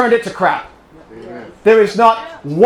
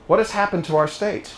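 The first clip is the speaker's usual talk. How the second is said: normal talking voice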